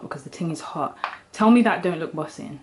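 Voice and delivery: ordinary talk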